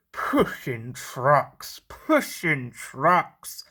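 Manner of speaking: Henry sulking as he pushes trucks; sulky mutter. A narrator's reading. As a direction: grumble it slowly under your breath. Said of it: "he muttered in a sulky sort of way".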